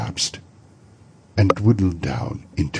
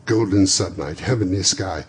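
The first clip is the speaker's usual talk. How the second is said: normal talking voice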